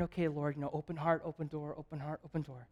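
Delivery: low, soft murmured prayer; quiet prayer. Retold or imitated. Woman praying to herself at the festival, quiet prayer. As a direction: pray softly under your breath, pitched low. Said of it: "just praying".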